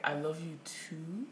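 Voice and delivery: slow, soft, questioning rise; awkward, drawn-out reciprocation; unsure reply. Retold or imitated. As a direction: answer it hesitantly, as if testing. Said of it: "is that the right response?".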